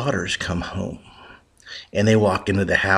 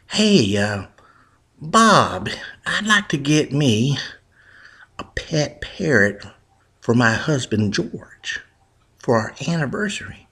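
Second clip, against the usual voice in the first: a hint of a higher housewife voice; mild higher wife voice; lightly voiced wife; 6 semitones higher than usual. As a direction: play the wife sweetly asking the shopkeeper.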